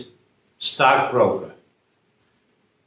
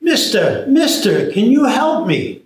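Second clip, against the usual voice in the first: high, louder plea; calling up for help; 9 semitones higher, 4 dB louder than usual. Acted. Tommy calling to the stranger, high loud plea. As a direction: call up to him, climbing higher. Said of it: "he yells up".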